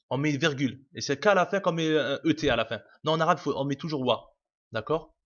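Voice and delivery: ordinary talk, nothing marked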